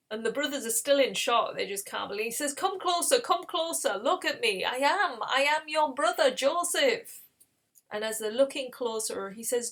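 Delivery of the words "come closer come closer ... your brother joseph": high, earnest insistence; acted emotional coaxing; insistent urging. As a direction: urge them to believe, voice raised. Joseph urges shocked brothers to believe.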